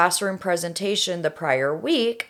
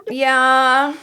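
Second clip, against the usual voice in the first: 911 caller; 6 semitones higher, 4 dB louder than usual. Acted. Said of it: "I am going to be Melissa".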